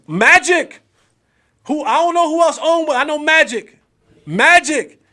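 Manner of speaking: loud, high, punchy hype calls. Real, not acted. Host loudly hyping the Dodgers owner. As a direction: cheer the name like a fan. Cheering Magic Johnson's Dodgers.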